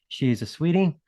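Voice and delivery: usual speaking voice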